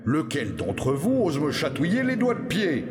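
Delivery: Robert indigné, un peu plus aigu; le forgeron s'offusque qu'on le chatouille; exclamation de Gros Robert. Acted. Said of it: "s'écria Gros Robert". (forgeron outré qui s'écrie)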